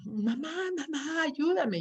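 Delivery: high, slow and strained, imitating a distressed child; mock anguished cry of mamá, pitched high; pained plea for help. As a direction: act out an extortion call in anguish. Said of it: "que es de tanto dolor que nos mueve".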